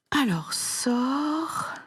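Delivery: slow whispered dictation. Shown as a demonstration. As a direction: breathe sorcier slowly like a secret. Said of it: "dictation whisper".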